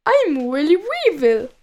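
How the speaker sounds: swooping, put-on crewman voice; comic sing-song pirate. A narrator's reading. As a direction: introduce yourself with exaggerated rises and falls. Pirate introduces himself in own voice.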